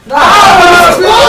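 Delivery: sudden loud high yelp of delight; a real burst of excitement; excited shout. Real, not acted. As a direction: blurt it out loud and high, thrilled. Excited shout at Finland news.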